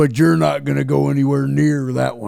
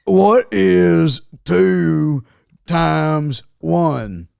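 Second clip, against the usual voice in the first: drawn-out, slow question; patronizing slow quiz to a baby; much slower than usual. Pretend. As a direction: spell it out slowly for a baby. Quizzing a baby slowly.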